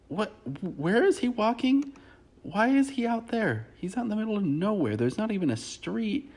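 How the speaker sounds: high-pitched baffled outburst; incredulous. Real, not acted. Commentator baffled by him walking into the woods, high-pitched incredulity. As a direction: question it in comic disbelief, voice way up.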